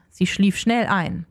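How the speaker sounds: ordinary delivery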